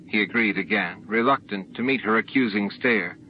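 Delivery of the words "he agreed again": plain reading voice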